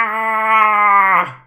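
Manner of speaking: loud, high, drawn-out yell; dwarf's battle scream. Acted. Dwarf about to leap off a cliff, screaming. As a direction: scream as you charge in. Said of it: "he's also screaming".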